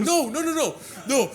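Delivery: high, rapid no no no; insistent no. Retold or imitated. Insisting the driver post it, high and eager. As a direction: cut him off eagerly.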